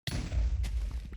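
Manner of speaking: sudden loud breathy gasp of fright; real startled gasp; sharp startled gasp. Real, not acted. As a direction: gasp as if caught off guard. Startled while gaming.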